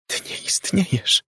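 terrified high whisper. Acted. Laura whispers in fear to the girl behind the curtains. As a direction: breathe it out in fear. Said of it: "wyszeptała".

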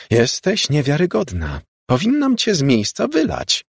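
angry boss voiced at a high pitch; indignant outburst. A narrator's reading. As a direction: let the indignation climb sharply in pitch. Angry boss threatens to fire her.

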